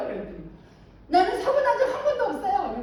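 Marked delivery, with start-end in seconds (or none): 1.1-2.8 much higher, louder mock huffy insistence; hammed-up indignant woman; indignant ajumma. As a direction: huff it out like the offended ajumma. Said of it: "그러시는 거예요".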